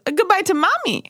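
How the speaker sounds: put-on high, indignant little-boy voice; indignant toddler. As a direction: play the cross toddler demanding his mom's turn. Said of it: "turned to the teacher with anger and goes".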